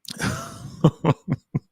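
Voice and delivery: real high-pitched giggling laugh. Real, not acted. Laughing about his own impression.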